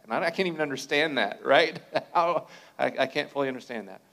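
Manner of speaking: fast, loud, laughing; excited. Real, not acted. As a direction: burst out with amazement. Exclaims, laughing.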